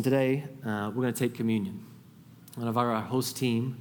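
ordinary talk

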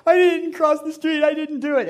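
high, loud, wailing denial; ugly cry. Retold or imitated. Boy caught crossing the street, sobbing his denial. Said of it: "It was an ugly cry".

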